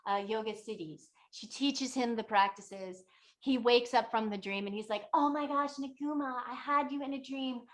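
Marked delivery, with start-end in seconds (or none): 5.1-7.6 higher-pitched, lightly mimicked wonder; mildly acted excitement. Waking traveler tells Naguma of the dream, mild excitement. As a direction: gush a little, as the retold dreamer.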